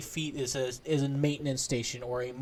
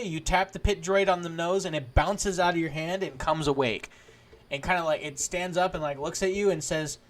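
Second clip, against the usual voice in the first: ordinary delivery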